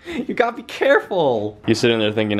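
ordinary talk, nothing marked

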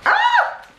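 high, loud squeal of delight; sudden happy scream; excited squeal. Real, not acted. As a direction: shriek with pure joy. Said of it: "I'm literally like".

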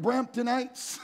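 high teasing call to the crowd; playful call out. Real, not acted. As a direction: tease the audience by name. Calling out to audience.

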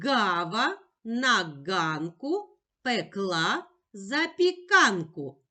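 slow tongue twister with pauses between words; повільна скоромовка. Shown as a demonstration. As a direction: recite slowly for a child to repeat. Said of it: "А тепер швидше". (повільно, з паузами між словами)

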